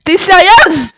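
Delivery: high, loud disbelief; loud, indignant questioning; outraged shout. Real, not acted. Outraged at Chiara in game.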